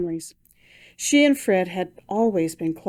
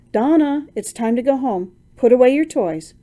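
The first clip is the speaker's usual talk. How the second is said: put-on high, louder, bright call to child; forced cheer; 5 semitones higher, 5 dB louder than usual. Mother calling daughter, forced cheerful voice. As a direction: sound cheery over hidden tears. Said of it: "make her voice sound cheery".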